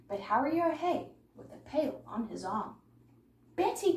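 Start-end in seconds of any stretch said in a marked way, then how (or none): 3.6-4.0 high, loud call; alarmed cry. A narrator's reading. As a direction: cry out her name across the farm. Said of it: "cried Harry".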